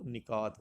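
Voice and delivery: نکات as "ni-kaat", short i first; correct pronunciation. Shown as a demonstration. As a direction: say نکات with "ni" at the start. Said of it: "درست option ہے وہ".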